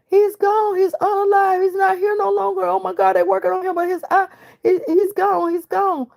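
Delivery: imitating a hysterical wife on the phone; very high, frantic, repeating in panic; breathless high-pitched run of distress. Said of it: "she's hysterical. She's crying".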